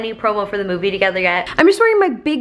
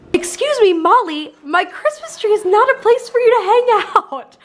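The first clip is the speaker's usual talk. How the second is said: high-pitched exasperated scolding; shrill-free, high pet-scolding voice, up an octave; scolding the cat; 8 semitones higher than usual. Real, not acted. Owner scolding her cat Molly in the tree, high exasperated scolding.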